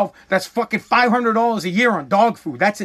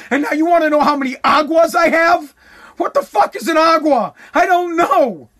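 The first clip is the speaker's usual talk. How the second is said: higher, louder fed-up yelling; exasperated shout; 6 semitones higher, 4 dB louder than usual. Retold or imitated. Fed up with the lady, he shouts at her in disbelief. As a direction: blow up in disbelief.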